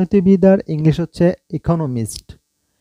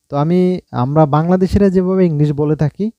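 normal talking voice

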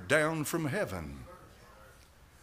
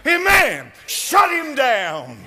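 fervent shout; 9 semitones higher, 9 dB louder, much slower than usual. Real, not acted. Preacher's climax at full force.

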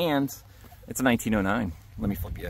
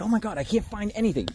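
higher, whiny imitation of a discouraged digger; mock despair; 10 semitones higher, 4 dB quieter than usual. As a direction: mimic a friend moaning that he finds nothing. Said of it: "You're like".

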